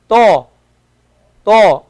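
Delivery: a thick, heavy t; emphatic Arabic ta, said loudly. Shown as a demonstration. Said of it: "It's not the same t".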